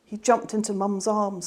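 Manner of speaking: plain reading voice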